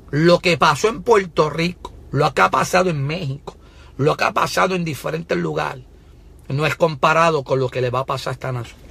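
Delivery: preaching judgment on the nation; full-force preaching. Real, not acted. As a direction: press the warning home. Prophetic preaching continues.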